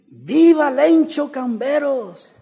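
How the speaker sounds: high, very slow, stretched rally call; imitated grito. Stand-in ending his grito at the tribune, drawn-out acted shout. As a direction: draw the name out grandly for the crowd. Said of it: "empieza a gritar".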